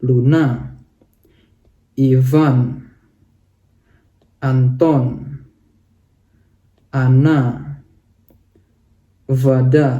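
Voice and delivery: Luna, Ivan, Anton, Ana, Vada each with a stressed syllable; stress demo list. Shown as a demonstration. Teacher reading example names with the stress marked. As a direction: read the names with a slight rise on the stressed syllable. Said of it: "nadanya sedikit naik".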